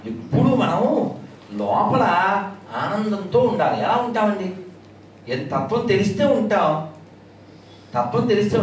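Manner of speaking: ordinary talk, nothing marked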